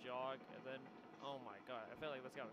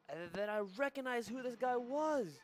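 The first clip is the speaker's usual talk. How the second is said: loud, high, drawn-out climax; dramatic reveal; 9 semitones higher, 9 dB louder, slower than usual. Real, not acted. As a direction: build the big revelation slowly and loudly. Dramatic reveal in his story.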